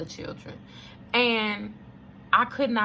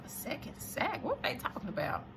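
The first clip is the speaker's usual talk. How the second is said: breathless, hushed disbelief; shocked whisper; 3 semitones higher, 10 dB quieter than usual. Retold or imitated. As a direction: barely voice it, stunned. Stunned inner reaction to overheard words.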